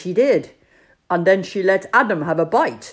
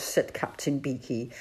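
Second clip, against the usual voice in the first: plain reading voice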